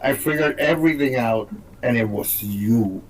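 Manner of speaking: mock-solemn detective reveal; grave accusation. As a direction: drop low and draw out the accusation. Acted accusation from the film.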